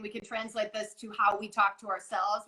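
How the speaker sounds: usual speaking voice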